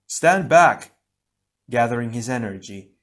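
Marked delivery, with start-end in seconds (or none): 0.1-0.9 loud, high urgent shout; shouted battle command; shouted warning. A narrator's reading. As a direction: yell at him to get clear. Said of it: "Pohatu shouted".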